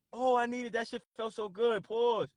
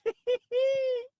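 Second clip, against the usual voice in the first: high squealed laugh; helpless laughing; 12 semitones higher, 4 dB louder than usual. Real, not acted. Streamer losing it mid-sentence, high helpless laugh. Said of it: "why I'm laughing so hard".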